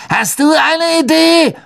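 usual speaking voice

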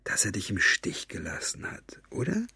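hushed, conspiratorial whisper; villain whispering a cruel question; whispered taunt. A narrator's reading. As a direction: lean in and whisper the barb. Villain taunts Maggie confidentially.

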